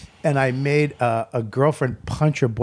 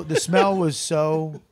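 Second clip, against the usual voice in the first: ordinary talk, nothing marked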